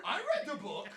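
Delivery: high, insistent bluffing student; mocking student voice. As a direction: insist you read it, mockingly. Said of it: "the kid in the essay just dropping in".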